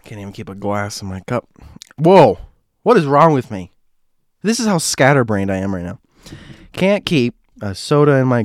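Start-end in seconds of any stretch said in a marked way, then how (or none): none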